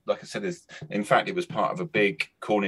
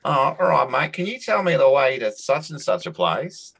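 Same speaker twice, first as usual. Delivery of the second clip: put-on old Aussie local's voice, pitched up and louder; higher-pitched Aussie impression; Australian accent; 7 semitones higher, 3 dB louder than usual. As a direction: imitate a sun-baked old Australian asking the way. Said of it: "You, you do that accent well".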